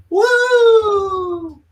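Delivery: high, loud Australian whoop; excited whoop. Pretend. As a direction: let out a big joyful cheer. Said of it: "a spin in an Australian accent".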